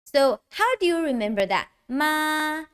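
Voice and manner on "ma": MA held high and level; first tone. Shown as a demonstration. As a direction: say "Ma" on a high, flat pitch. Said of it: "First tone".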